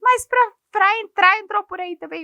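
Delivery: high, loud imitation of a daughter; mock-alarmed childish questioning. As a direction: ask it like an appalled kid.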